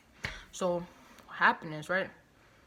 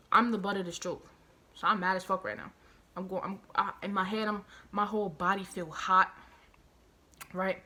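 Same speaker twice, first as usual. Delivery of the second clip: normal talking voice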